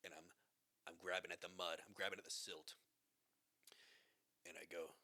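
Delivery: ordinary delivery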